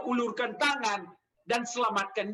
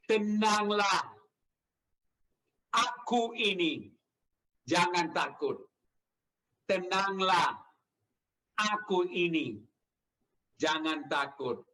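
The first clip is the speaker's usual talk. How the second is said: measured, spaced phrases dropping lower; slow reassurance; 4 semitones lower, slower than usual. Retold or imitated. Preacher quotes Jesus to disciples in the storm, slow reassurance. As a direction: voice Jesus calming the fearful, unhurried.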